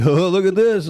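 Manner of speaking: high, playful exclamation; mock teasing. Imitating a spouse grabbing his belly, mock teasing.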